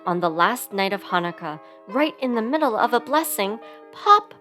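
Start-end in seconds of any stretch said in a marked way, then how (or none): 3.9-4.4 high, loud burst; popping sound word. A narrator's reading. Narrator tells of second button bursting, loud high pop.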